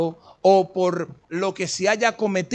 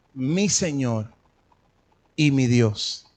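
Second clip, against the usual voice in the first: confesión reverente; 7 semitones lower, slower than usual. Retold or imitated. Tomás confiesa a Jesús.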